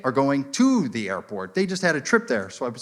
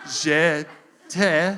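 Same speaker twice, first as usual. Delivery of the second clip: deliberate, spaced-out mock French lesson; slowed down. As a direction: fake French one syllable at a time. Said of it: "I'll slow it down for you".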